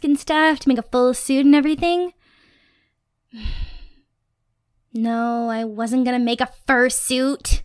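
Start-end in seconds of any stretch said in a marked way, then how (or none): 3.3-3.8 short soft sigh out; exasperated sigh. Real, not acted. To teasing partner, an exasperated sigh. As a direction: let out a tired breath. Partner teases about fursuit.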